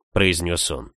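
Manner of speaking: ordinary delivery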